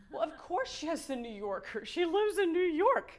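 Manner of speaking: wry, rising to a laugh; self-mocking. Real, not acted. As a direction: poke fun at yourself. Mocking own surprise.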